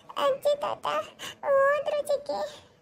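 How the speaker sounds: put-on high, slow child's voice, half sob; a little girl gulping between sobs; sobbing little girl. Niece telling her aunt her grandparents left, high sobbing child voice. Niece Ammu crying on phone.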